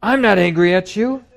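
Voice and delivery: high, insistent denial with a rising end; mimicking someone who insists they aren't angry, high and pushed; defensive denial. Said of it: "that sort of".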